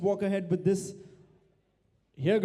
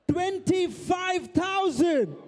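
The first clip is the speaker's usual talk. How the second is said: auctioneer's call; 7 semitones higher, much slower than usual. Real, not acted. Auctioneer calls a big bid.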